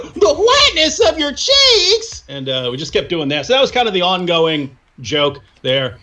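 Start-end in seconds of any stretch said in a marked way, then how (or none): 0.2-2.2 put-on high, slow, astonished hillbilly accent; shocked hillbilly accent. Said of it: "would say it in shock and awe".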